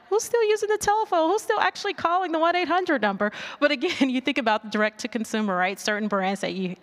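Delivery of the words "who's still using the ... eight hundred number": high, fast mock disbelief; incredulous. Mocking old ads to the audience, high fast disbelief. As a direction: scoff at anyone still phoning in. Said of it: "I was like".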